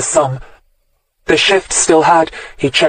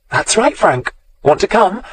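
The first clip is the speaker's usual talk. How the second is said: sweetly; 6 semitones higher than usual. A narrator's reading. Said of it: "Hilary asked sweetly".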